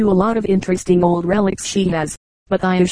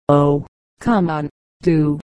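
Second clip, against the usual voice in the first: lower, slow coaxing plea; pleading; 4 semitones lower, slower than usual. A narrator's reading. Visitor begging the gossip to tell more, low slow pleading. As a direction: draw it out, begging her to go on. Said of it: "pleads the visitor".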